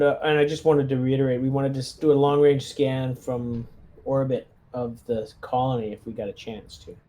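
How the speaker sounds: ordinary delivery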